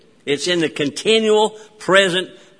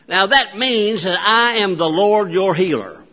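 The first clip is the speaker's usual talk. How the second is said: normal talking voice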